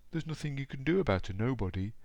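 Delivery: high, plaintive storybook voice; mock weeping. A narrator's reading. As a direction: act tearful self-pity for a children's story. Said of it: "wept the person who sort of was".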